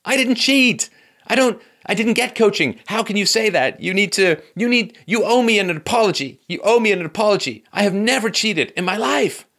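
high, loud-edged indignant insistence; Serena Williams's angry protest. Retold or imitated. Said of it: "Serena Williams".

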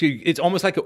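normal talking voice

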